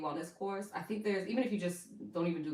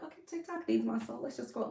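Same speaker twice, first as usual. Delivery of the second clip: lightly mimicked viewer, pitched higher; mock viewer; 4 semitones higher, 3 dB quieter than usual. Host imitates her viewers excusing themselves, mock viewer voice. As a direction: put on a higher voice for the audience's excuse. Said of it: "some of y'all like".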